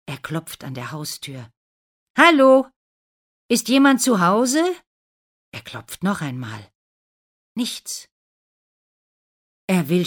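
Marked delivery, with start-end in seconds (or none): 2.2-4.6 high, loud calls at the door; calling out. Acted. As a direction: call through the door to wake the house. Prince knocking, calling into house.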